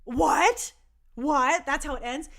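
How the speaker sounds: can't believe it's over; shocked disbelief. Retold or imitated. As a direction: fan reacting to a cliffhanger ending. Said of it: "And I was like".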